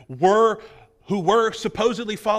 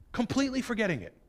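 quick soft aside; 3 semitones higher, 5 dB quieter, faster than usual. Acted. Preacher repeats the point.

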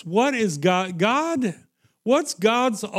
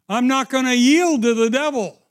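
louder, higher repeat; defiant; 5 semitones higher, 5 dB louder than usual. Real, not acted. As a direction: declare it firmly.

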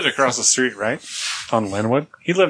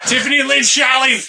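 hollered double name; 8 semitones higher than usual. Acted. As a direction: yell both names up high. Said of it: "You gotta say Tiffany Lynn".

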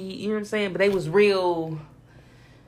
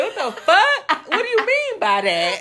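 high-pitched, laughing incredulity; hammed-up baffled outburst, pitched way up; laughing disbelief; 11 semitones higher, slower than usual. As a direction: squeal it in mock bafflement through laughter. Acting out baffled reaction to greeting.

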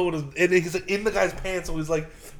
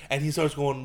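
ordinary talk

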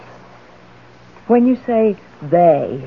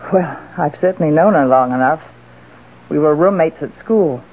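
normal talking voice

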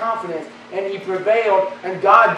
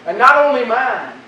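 usual speaking voice

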